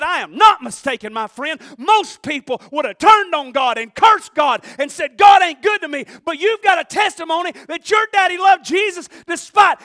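high, loud preaching shout; shouted insistence. Retold or imitated. Preacher insisting to the angry man, shouting. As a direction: drive it home with full force.

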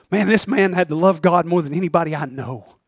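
normal talking voice

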